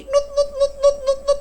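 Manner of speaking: high loud beeps; meter beeping. Retold or imitated. Wife imitating the power meter's warning beeps, high and loud. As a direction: beep like an electric meter. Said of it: "token listrik bunyi".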